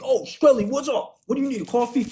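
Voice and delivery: high-pitched, loud deli owner greeting; put-on high voice hailing a regular; Tony. Bagel store owner greets a regular customer, hammed-up high voice. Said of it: "Tony runs this, the bagel store".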